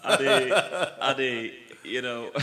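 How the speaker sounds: host losing it to laughter; laughing. Real, not acted. Host cracking up at story.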